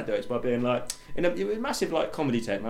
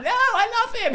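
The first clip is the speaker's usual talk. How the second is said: very high-pitched drawn-out protest; mock lovelorn wail; slower than usual. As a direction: imitate a girl being dragged from her beloved. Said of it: "She's like".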